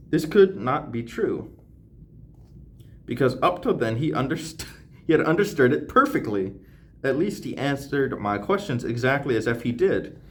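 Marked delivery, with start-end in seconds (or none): none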